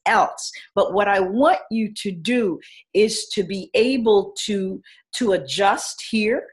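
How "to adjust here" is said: normal talking voice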